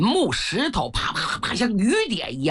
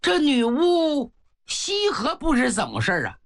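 ordinary delivery, nothing marked

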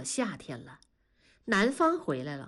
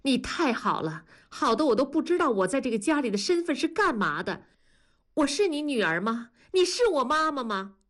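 higher-pitched, accusing questions; bitter reproach; 6 semitones higher than usual. Acted. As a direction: throw hurt questions at mother. Daughter's acted quarrel with mother. (提高音调，委屈地质问母亲)

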